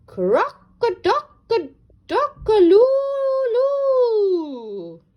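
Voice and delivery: high, loud, slow silly crow; failed crow. Acted. Rupert tries to crow, loud silly crow. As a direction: botch a rooster crow loudly. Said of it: "threw back his head and shouted".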